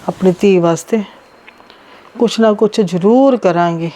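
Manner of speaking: low, heavy-hearted mother thinking aloud; burdened, halting low murmur of resolve; troubled soliloquy. Acted. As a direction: worry to yourself in a low voice, with long pauses. Worried mother alone after tearful call.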